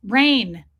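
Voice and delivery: ordinary talk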